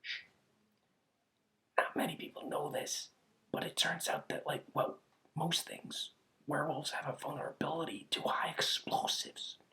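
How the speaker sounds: hushed, conspiratorial whisper; secret whisper. Acted. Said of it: "I'll let you in on a little secret".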